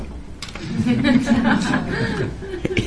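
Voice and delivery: long, high giggle; helpless high-pitched chuckling; amused laughter. Real, not acted. Amused while telling the story.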